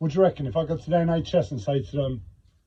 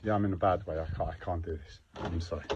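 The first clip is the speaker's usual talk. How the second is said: very low, soft, drained voice; defeated apology; 8 semitones lower, 13 dB quieter, faster than usual. Real, not acted. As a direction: drop to a quiet, beaten admission. Admits being in a bad way.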